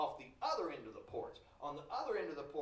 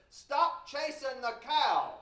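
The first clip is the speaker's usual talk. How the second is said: slow, loud yell from far off; yelling across the pasture; 9 semitones higher, 10 dB louder, much slower than usual. Retold or imitated. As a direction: bellow it down from the porch. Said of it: "he yells down and says".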